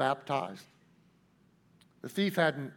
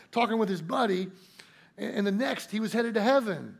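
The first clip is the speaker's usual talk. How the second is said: ordinary talk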